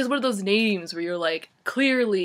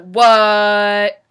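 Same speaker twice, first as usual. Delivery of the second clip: much louder, blurted; loud startled what; 13 dB louder than usual. Retold or imitated. She imagines reacting to her friend on the phone, shouting. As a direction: shout it at your gasping friend. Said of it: "I'd be like".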